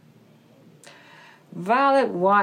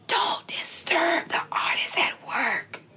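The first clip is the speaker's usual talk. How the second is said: whispering; 8 semitones higher, 5 dB quieter than usual. Acted. Beaver shushes, whispering.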